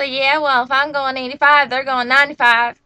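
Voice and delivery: loud, retold argument from mom; defensive comeback. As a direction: imitate mom arguing back loudly.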